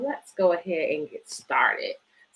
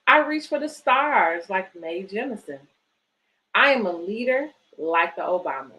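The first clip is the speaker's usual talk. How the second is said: put-on reading to children. Reads picture book aloud.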